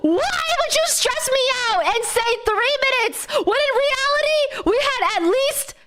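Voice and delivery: high, indignant complaining; exasperated rant. Retold or imitated. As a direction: vent at the airline in a high whine. Ranting at the airline.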